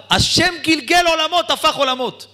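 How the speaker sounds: high, slow, ringing cry of wonder; fervent exclamation. Acted. Preacher crying out to the audience at the story's climax, high and awed. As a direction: proclaim it up high and slowly, in awe.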